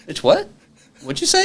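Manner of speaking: shrieking high, stunned; shocked disbelief. Retold or imitated. Hearing a date ask about cocaine, shocked disbelief. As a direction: nearly squeak in disbelief. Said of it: "I was like".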